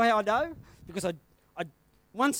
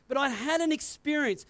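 usual speaking voice

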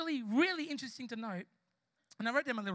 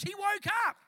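high, loud triumphant cry; jubilant shout; 8 semitones higher, 8 dB louder than usual. Real, not acted. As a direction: rouse the congregation with it. Urging church to celebrate.

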